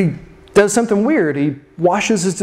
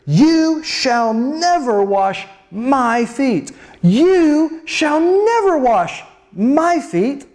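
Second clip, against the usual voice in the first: drawn-out YOU and MY, pitched up, very slow; stress on YOU and MY; 5 semitones higher, much slower than usual. Acted. Peter refusing Jesus the foot washing, heavy stress on YOU and MY. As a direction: lean long and hard on "you" and "my", indignant refusal. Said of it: "I put the emphasis on you and my".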